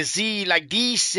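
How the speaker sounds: lightly hammed-up Hollywood French accent, slow; a slow parody of fake movie French; mock movie French accent. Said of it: "when you see people in movies, uh, talking".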